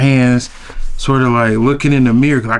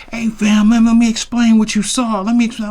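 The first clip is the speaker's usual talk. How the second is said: much higher, earnest "let me explain"; a hint of a pleading friend; 10 semitones higher than usual. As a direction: play Big K rushing out to smooth it over.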